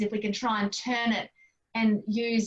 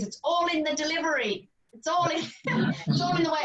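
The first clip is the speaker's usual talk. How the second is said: amused catchphrase; 7 semitones higher than usual. Real, not acted. Amused repeating her own catchphrase.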